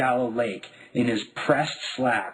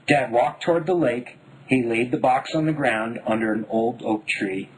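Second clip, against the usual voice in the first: usual speaking voice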